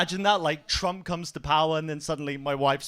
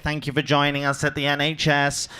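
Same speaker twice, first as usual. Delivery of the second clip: chirpy theme-park staffer; 5 dB louder than usual. Retold or imitated. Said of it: "She was there like".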